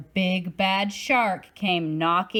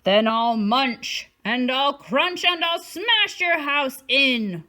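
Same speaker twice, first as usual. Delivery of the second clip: shark roar; 3 semitones higher, 4 dB louder than usual. Acted. Shark threatening Jim and Tim, hammed-up roar. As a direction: growl out each threat. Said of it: "roared the shark".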